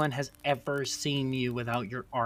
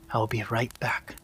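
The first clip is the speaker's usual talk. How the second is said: whispered; 2 semitones lower than usual. Acted. Luvala whispers to the half-conscious Warren, hushed. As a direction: whisper it as you slip away.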